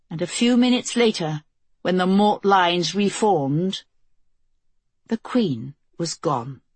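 ordinary delivery

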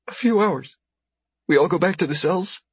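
high, timid injured woman; thin high voice, scared; frightened woman voice. Acted. Captive woman answers Carson.

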